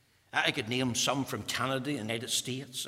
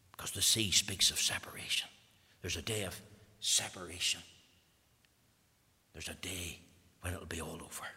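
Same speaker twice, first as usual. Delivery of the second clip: lower, hushed, half whispered; solemn whisper; 7 semitones lower, 5 dB quieter than usual. Acted. Preacher hushing the congregation about the day of separation. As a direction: let it sink in, almost breathless.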